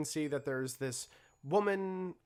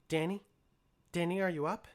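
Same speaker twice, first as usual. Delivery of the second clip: put-on higher, light voice of an eager herd girl; Mixie waking him; 5 semitones higher, faster than usual. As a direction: call him gently awake. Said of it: "Mixie look down to you and say".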